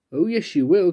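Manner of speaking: ordinary delivery, nothing marked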